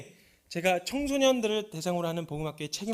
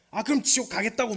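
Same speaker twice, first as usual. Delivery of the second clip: mock defiant teen, louder and higher; 6 semitones higher, 7 dB louder than usual. Mimicking the defiant boy to the congregation, higher and louder. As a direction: throw back a sulky teen's defiance. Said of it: "이러고 발광을 하기 시작했어요".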